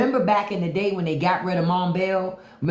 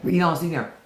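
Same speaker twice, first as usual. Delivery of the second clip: ordinary delivery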